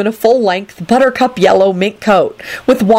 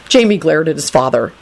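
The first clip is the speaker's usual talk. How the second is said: usual speaking voice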